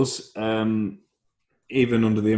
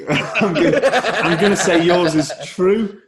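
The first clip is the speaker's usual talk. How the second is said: laughing through the words, slowed down; can barely get it out for laughing; giggling verdict; 9 semitones higher, 6 dB louder, slower than usual. Real, not acted.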